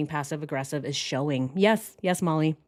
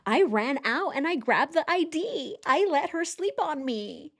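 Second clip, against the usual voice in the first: higher, dramatic imitation; theatrical mimicry; 7 semitones higher than usual. Mocking the husband's caring-man story, higher theatrical tone. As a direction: overplay it, all feeling. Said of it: "he's very, very theatrical".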